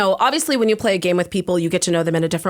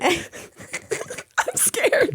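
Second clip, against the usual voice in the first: high, squeaky laughing voice; softly giggling, very high-pitched; laughing nervously; 11 semitones higher, 4 dB quieter than usual. Real, not acted. Laughing, nervous about the story.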